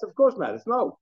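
high-pitched, incredulous brush-off; scoffing, high-voiced denial; dismissive answer. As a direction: knock the idea down, pitch high. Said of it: "If it's a question mark".

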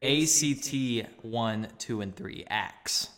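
A C T spelled letter by letter; spelled out slowly. Shown as a demonstration. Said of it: "Not axes".

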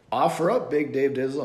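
mocking quote. Quoting the rival's jab in a league chat, mock taunt. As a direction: toss it off like a smug leaguemate. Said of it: "he said".

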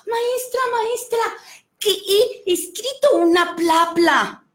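high, eager calls to the teacher; a child bursting to tell his news; boy calling to his teacher, high and excited. Acted. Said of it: "llegó con su maestra y le dijo".

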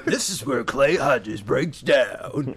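slow, drawn-out announcer read; mock show intro, every word stretched; voiceover voice. Introducing his own Words of Wisdom segment, slow voiceover voice. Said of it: "You should do YouTube, like, voiceovers".

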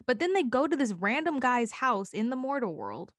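ordinary talk, nothing marked

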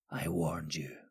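low, very soft mutter. A narrator's reading. Wizard Jihur's last warning before striking, low mutter. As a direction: drop the voice to an ominous murmur. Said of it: "Jihur lowered his voice and muttered".

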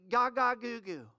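light mock baby talk; a preacher briefly imitating a babbling infant; baby babble. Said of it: "when a child begins to talk".

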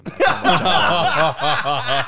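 high, loud laugh-filled squeal; helpless laughter at the punchline. Real, not acted. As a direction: crack up while saying it.

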